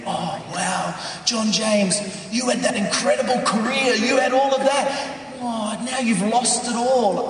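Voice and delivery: high-pitched mock amazement and pity; gushing sympathisers. People he meets pitying his lost career, high-pitched gushing. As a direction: imitate people gushing over what was lost. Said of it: "people and they say to me".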